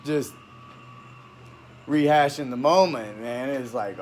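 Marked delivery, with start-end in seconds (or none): none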